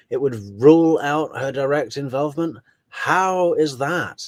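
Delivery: incredulous echo. Real, not acted. Host challenging Grimley's claim about her involvement, incredulous. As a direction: throw the claim back as a baffled question. Echoes the claim back in disbelief.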